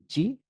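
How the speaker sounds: জি said as American zee; US zee letter. Shown as a demonstration. To English learners, the US letter name জি. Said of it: "US অ্যাকসেন্টে এটাকে পড়া হয় জি".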